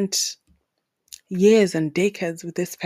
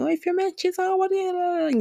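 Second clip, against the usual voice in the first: gossiping friends; 12 semitones higher than usual. Retold or imitated. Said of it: "always discuss cheating".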